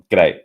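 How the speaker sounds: "G'day" in one quick burst; "G'day" with the first vowel swallowed. Shown as a demonstration. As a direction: squash "G'day" into a single short word. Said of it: "It, it's like".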